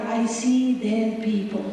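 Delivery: normal talking voice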